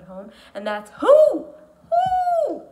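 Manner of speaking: WHO sung out high and loud; emphasized core word. Shown as a demonstration. Teacher modelling the word WHO for students, high and loud. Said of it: "one other word that I'm going to emphasize".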